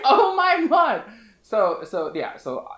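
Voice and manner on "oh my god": stunned realization. Retold or imitated. Realizing engagement is real.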